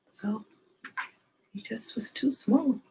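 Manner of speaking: hushed, sad whisper; soft whisper. A narrator's reading. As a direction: breathe it out gently, letting the sadness sit. Sad moment in children's story.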